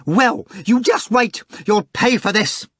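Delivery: high, loud furious threat; screaming rage. A narrator's reading. Manager threatening the caller, screaming in rage. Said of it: "screamed in a rage".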